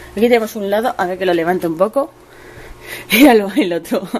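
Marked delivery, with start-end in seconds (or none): 2.6-4.2 laughing. Real, not acted. Laughs at the cat.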